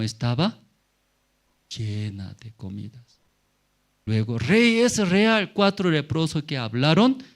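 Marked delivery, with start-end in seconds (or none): 4.4-7.2 high, raised-voice report to the king; excited confirmation. Acted. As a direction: break the confirmed news eagerly. Scout reports back to the king.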